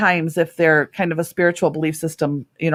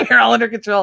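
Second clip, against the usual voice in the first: laughing tease; 5 semitones higher, 5 dB louder, faster than usual. Retold or imitated. Laughing through her retort.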